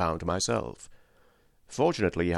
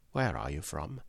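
very soft, low aside; hushed, secretive question; covert question; 5 semitones lower, 9 dB quieter than usual. A narrator's reading. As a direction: ask it under your breath, pitched down. Interpreter slips in secret question.

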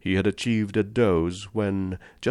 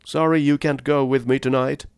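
calling after him, raised voice; 6 semitones higher, 5 dB louder than usual. A narrator's reading. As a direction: call it out across the room. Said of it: "called the general".

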